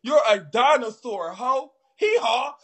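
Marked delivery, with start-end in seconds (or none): 2.0-2.5 high mock donkey bray; hee-haw him like a donkey. Mocking caller as a donkey.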